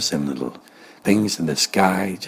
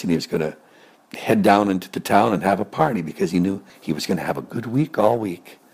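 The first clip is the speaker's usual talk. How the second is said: ordinary delivery, nothing marked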